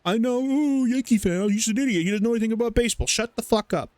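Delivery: lightly voiced jeering baseball fans; mocking Yankee haters. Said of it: "you guys are about to hate me".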